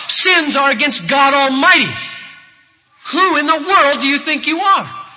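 high, loud scandalized shouting; full-voiced indignant challenge; outraged accusation. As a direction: berate him in furious disbelief. Voicing the outraged leaders accusing Jesus.

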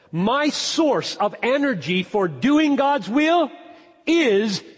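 high, loud, slow proclamation; thundering from the pulpit; full-force preaching. Real, not acted. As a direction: preach it slowly and forcefully, weighting each phrase.

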